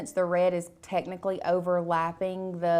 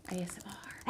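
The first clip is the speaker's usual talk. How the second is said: very soft, unvoiced playful whisper; mock ASMR whisper; 13 dB quieter than usual. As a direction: whisper it like a parody ASMR host.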